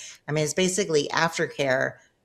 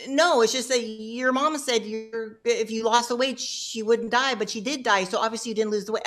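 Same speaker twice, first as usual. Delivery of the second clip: imitating the counselor backpedaling; 7 semitones higher than usual. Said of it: "And he was like".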